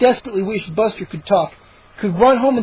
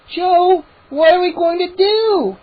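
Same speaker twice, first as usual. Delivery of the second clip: frightened; 11 semitones higher, 3 dB louder, slower than usual. A narrator's reading. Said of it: "Mindy's frightened voice".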